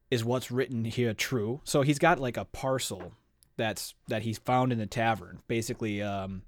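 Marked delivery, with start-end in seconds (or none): none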